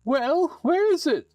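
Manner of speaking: high, loud cackling witch casting a spell. Acted. Witch cursing the player into a frog, high and gleeful.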